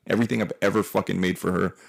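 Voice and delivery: usual speaking voice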